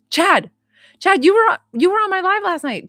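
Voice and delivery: high and loud, thrilled to see him; excited. Real, not acted. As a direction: call his name, delighted. Excited to see viewer again.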